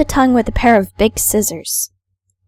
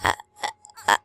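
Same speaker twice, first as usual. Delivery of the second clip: terrified; 6 semitones higher than usual. Acted. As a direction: gasp out each word in fear. Character paralysed by ghost.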